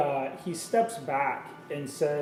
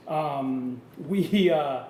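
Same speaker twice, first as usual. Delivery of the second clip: ordinary talk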